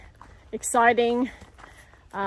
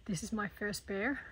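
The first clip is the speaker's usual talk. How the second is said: very soft, hushed and tense; hushed whisper; 2 semitones lower, 14 dB quieter than usual. Real, not acted. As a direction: barely breathe the words near a bear. Bear encounter, whispering on camera.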